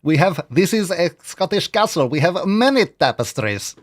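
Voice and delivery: put-on Scottish accent. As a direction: boast about the tapestries in a mock Scottish brogue. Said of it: "I have to say it with a, with an accent".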